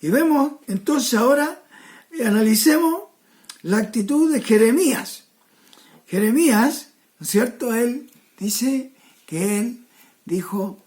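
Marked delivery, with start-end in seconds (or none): none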